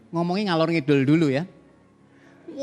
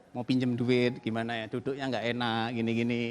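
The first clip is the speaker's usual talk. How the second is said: mime an awkward man too shy to ask for a loan, softly; nervous borrower; 6 semitones lower, 8 dB quieter than usual. Acted. Preacher mimics an uneasy debtor working up to ask, quiet and hesitant. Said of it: "Eh, mulai salah tingkah gini ya".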